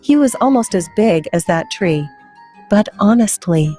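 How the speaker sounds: ordinary delivery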